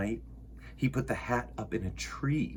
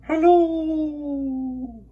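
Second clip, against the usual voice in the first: echo; 14 semitones higher, 5 dB louder than usual. Acted. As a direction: let it ring back from far away. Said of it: "his own voice echoed off".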